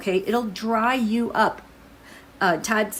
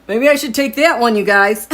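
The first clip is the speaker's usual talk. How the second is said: loud, raised exclamation; excited outburst; 4 semitones higher, 10 dB louder than usual. Real, not acted. Tarot reader to viewers after a surprising card, loud excitement. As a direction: blurt it out loudly to the viewers.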